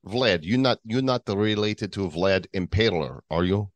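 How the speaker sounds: teasing question in a mock Russian accent; comic Russian correspondent voice. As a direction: put on Russian to quiz a fellow correspondent. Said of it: "So we do as Russian today".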